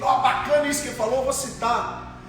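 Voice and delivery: mock eager colleague.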